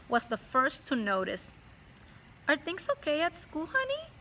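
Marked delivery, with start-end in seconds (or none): 2.5-4.1 higher, gently asking mom voice; caring mom. Acted. Mom asks her son about school, higher and caring. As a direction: ask like a concerned mother. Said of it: "she asked".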